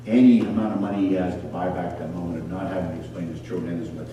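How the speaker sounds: ordinary talk, nothing marked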